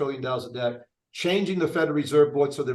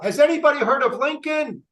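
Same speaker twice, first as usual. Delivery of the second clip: mock yell; 8 semitones higher than usual. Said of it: "or yell out".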